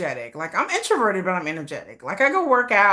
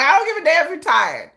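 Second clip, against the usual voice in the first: sassy dismissal; 8 semitones higher, 5 dB louder than usual. Retold or imitated. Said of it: "I'm like".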